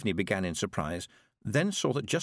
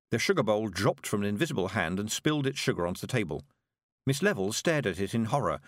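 ordinary delivery

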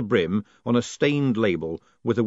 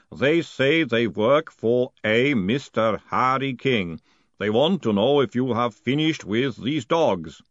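slow, deliberate golem voice; dutiful golem; slower than usual. Acted. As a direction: speak as a patient golem, word by word.

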